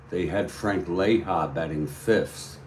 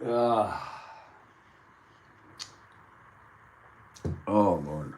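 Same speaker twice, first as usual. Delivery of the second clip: groaning in heartburn pain; strained, pained groans; 3 semitones higher, 4 dB quieter than usual. Real, not acted. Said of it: "I have heartburn".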